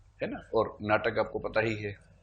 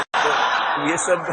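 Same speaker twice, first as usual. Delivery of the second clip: laughing; 4 semitones higher, 8 dB louder, much slower than usual. Real, not acted.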